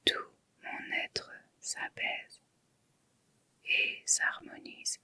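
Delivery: inward murmur. Shown as a demonstration. Yoga teacher murmuring the sankalpa inwardly, very faint whisper. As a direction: whisper it as if only to your own heart. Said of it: "Murmurez intérieurement trois fois".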